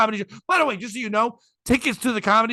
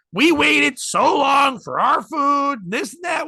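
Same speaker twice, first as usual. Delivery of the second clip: high-pitched, drawn-out customer griping; mock an aggrieved customer complaining; raised, stretched-out griping; indignant complaint; 6 semitones higher, much slower than usual. Retold complaint from angry customer.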